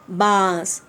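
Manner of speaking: usual speaking voice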